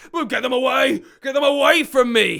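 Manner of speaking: high, loud frantic cry; panicked yell. Acted. Wizard chased by snarling dogs, panicked high yell. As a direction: yell for rescue at full pitch. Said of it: "he yelled".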